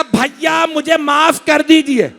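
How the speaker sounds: high, drawn-out chanted plea; preached plea. Acted. Zainab begging her brother, high chanted plea. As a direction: plead like a sister in lament.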